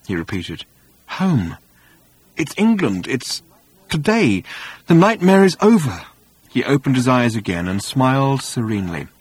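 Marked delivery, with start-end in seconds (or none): 1.2-6.0 raised, wondering voice that builds; rapturous relief. Acted. Arthur convinced the nightmare is over, rapturous relief. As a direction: marvel at being home, higher and higher.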